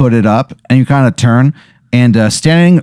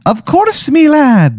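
high Irish leprechaun, winking; cheeky leprechaun, much higher; slower than usual. Acted. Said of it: "he winks and he goes".

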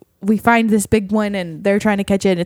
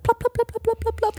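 high, soft plop plop sound effect; cute squeaky plops; frog plopping noise; 13 semitones higher, 6 dB quieter than usual. Retold or imitated. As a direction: mimic a tiny frog lure hopping back across the water. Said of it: "it came back and it was like".

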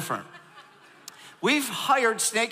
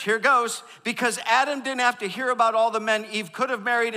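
ordinary talk, nothing marked

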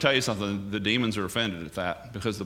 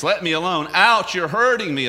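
higher, louder panicked cry; panicked demon scream; 6 semitones higher, 6 dB louder than usual. Retold or imitated. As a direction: beg to be left alone in pain. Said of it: "He screams, he panics".

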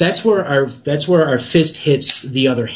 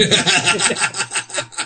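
laughing; 6 semitones higher, 5 dB quieter than usual. Real, not acted. Host laughs at drugged-up joke.

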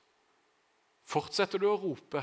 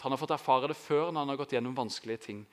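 usual speaking voice

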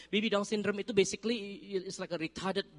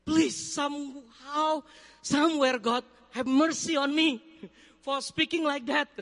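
higher, louder pleading to God; retold anxious prayer, raised voice; desperate prayer; 6 semitones higher, 4 dB louder, slower than usual. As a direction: beg God for mercy, slowly at first. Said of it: "Berdoa saya sama Tuhan".

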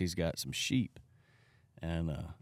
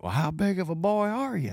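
challenging pastor; 11 semitones higher, 4 dB louder than usual. Retold or imitated.

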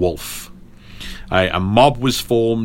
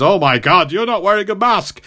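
high, squeaky hammed-up panic; a thug realising the monster is real; mock terror; 11 semitones higher than usual. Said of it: "he goes".

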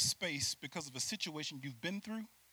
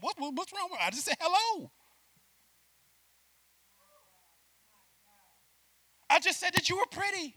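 higher, baffled protest; confused husband; 12 semitones higher, 5 dB louder than usual. Retold or imitated. Husband baffled at wife's outburst, higher confused protest. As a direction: play the puzzled husband.

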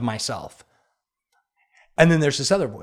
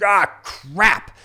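higher-pitched, drawn-out mock groan; acted-out exasperation; exasperated groan; 4 semitones higher than usual. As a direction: groan it out like you've been caught resting. Exhausted, caught resting by coach.

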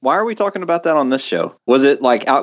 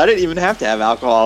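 ordinary talk, nothing marked

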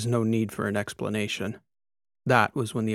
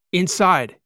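higher, loud single command; captain urging the astronomer in; shouted; 7 semitones higher, 6 dB louder than usual. A narrator's reading. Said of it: "Kikoa shouted to Iberico".

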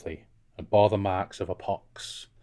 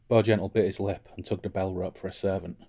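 usual speaking voice

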